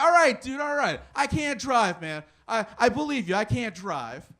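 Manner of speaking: loud, high, mock-frantic surrender; hammed-up shouting back at a heckler; exasperated yelling. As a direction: give in at full volume, fed up. Acted yelling back at Bob.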